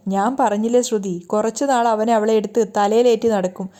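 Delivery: ordinary delivery, nothing marked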